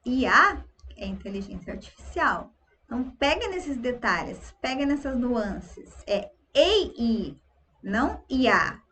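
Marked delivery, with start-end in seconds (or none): none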